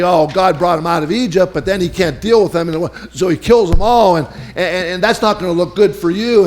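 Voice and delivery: high, loud jeering gossip; mock God's failure; mocking Egyptians. Egyptians jeering at God, lightly voiced. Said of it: "they're gonna say".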